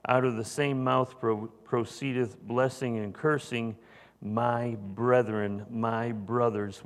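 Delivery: solemn scripture reading. Acted. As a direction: proclaim the verse like a preacher. Reads scripture aloud.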